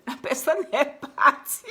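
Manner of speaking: high, loud exclamation breaking into laughter; mock amazement. Speaker marvels at someone suddenly gone, mock amazement.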